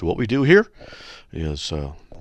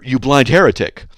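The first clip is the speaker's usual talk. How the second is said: loud insult; 5 semitones higher, 11 dB louder than usual. Pretend. Mock reply to wife's question.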